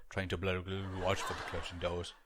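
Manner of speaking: low, mumbled, slurred train announcement; lazy mumbled announcer mock; muddled announcer. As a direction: mumble it like a bored driver. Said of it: "very muddled".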